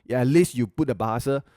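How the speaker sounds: usual speaking voice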